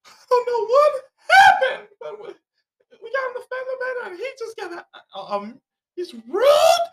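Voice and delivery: high, slow hammed-up wailing sobs; mock crying. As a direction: fake tearful complaint to the cop, hammed up. Mocks woman crying to police.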